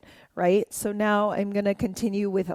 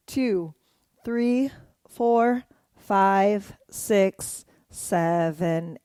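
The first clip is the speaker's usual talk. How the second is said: dance count; much slower than usual. Shown as a demonstration. Counting along to dance moves.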